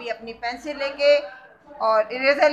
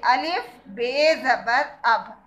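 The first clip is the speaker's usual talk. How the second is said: slow spelling out; slower than usual. Shown as a demonstration. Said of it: "इन दोनों की आपस में दोस्ती".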